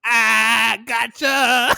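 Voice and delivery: high, shouted prank reveal; loud triumphant gotcha. Retold or imitated. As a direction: yell it like a prankster pouncing, hammed-up. Acting out grandpa springing the prank.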